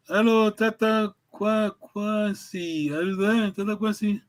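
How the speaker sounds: normal talking voice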